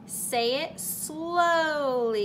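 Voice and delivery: drawn-out slow phrase; slow demo of saying it slowly; slowly like a turtle. Shown as a demonstration. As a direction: stretch it out like a turtle walks. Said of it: "like a turtle".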